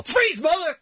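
high-pitched play-acted police shout; mock cop yell. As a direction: yell it like a movie cop, for fun. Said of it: "And I'm gonna yell".

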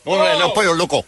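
imitating a put-on south-of-the-border Spanish accent; mock Mexican accent. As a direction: mimic the ad's sudden Mexican accent. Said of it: "All of a sudden, he's south of the border".

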